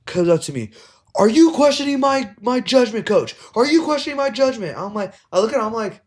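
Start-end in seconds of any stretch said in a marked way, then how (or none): 1.2-4.8 much higher, louder mock ref; mock the ref's power trip; imitating a pompous ref. Coach mocks the young ref challenging him.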